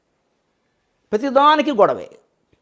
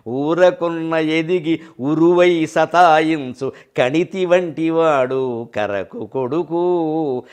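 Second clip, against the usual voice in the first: slow, drawn-out verse chanting; poem recitation; 2 semitones lower, much slower than usual. Acted. Preacher reciting Joshua's verse to the audience, slow and chanted. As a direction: chant it slowly, savouring each word.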